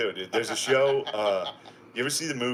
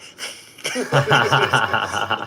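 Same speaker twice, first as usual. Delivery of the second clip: vampire's gloating laugh; 7 dB louder than usual. Retold or imitated. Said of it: "And he's like".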